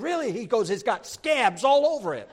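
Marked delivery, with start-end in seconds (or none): 0.0-0.3 high, startled "Really?"; surprised. Retold or imitated. Preacher answers the mechanic, high and startled. 0.7-2.3 loud punchline. Retold or imitated. Mechanic delivers the punchline.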